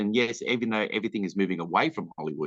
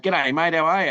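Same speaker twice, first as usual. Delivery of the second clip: higher, louder matey hail; imitated Aussie greeting; 8 semitones higher, 5 dB louder than usual. Stranded Aussie greeting a countryman on the street, hailing him loudly. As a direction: call out like a mate spotted on the street.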